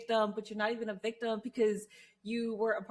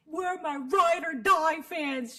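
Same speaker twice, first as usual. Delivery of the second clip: mock whiny wrestler; 6 semitones higher, 6 dB louder, much slower than usual. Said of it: "It's stupid".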